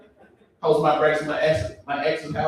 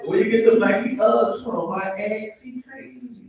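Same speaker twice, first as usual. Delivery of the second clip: imitated exchange; 9 semitones higher than usual. Acts out exchange about ruined boots.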